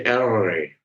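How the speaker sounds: "R" in an American accent, untrilled and drawn out; erre americana. Shown as a demonstration. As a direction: curl the tongue for an American "R". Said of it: "el americano dice". ("R" con acento americano, palatal)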